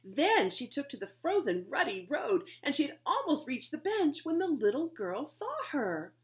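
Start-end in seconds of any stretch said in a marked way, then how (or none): none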